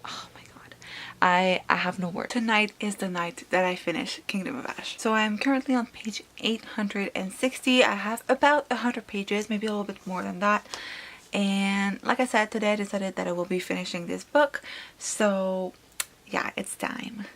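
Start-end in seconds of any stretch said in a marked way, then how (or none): 0.0-0.8 exasperated whisper. Real, not acted. Reader fuming to viewers about the ending, whispered. 15.0-17.2 soft, slow whisper of resolve; dramatic whisper. Real, not acted. Reader telling viewers she'll finish tonight, whispered.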